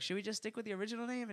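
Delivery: high, soft, quick suggestion; lightly put-on wife voice; imitated wife. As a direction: ask timidly, quick and high. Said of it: "the wife's like".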